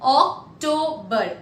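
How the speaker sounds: OCTOBER split as Oc, to, ber; syllable-by-syllable demo. Shown as a demonstration. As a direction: break "October" into three separate syllables. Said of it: "we'll pronounce it for you".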